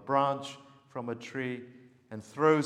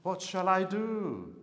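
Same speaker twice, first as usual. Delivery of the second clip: slow, higher desperate question; helpless cry; 7 semitones higher than usual. A narrator's reading. Young prophet asking Elisha what to do, slow helpless cry. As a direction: ask it as if at the end of your rope. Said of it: "this cry of despair".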